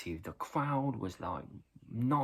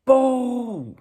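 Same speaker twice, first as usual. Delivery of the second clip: huge high-pitched bellow; full-force mock terrace shout; imitated fan yell; 11 semitones higher, 14 dB louder than usual. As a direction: holler it at the pitch, drawn out. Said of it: "they start yelling out behind us".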